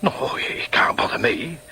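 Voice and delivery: slow unvoiced whisper to himself; whispered aside. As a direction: reassure yourself under your breath. Inner thoughts whispered aside.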